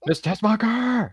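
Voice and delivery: high, slow yelled name; yelling a name. Retold or imitated. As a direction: bellow the name, high and drawn out. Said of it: "his best lines when he's just yelling".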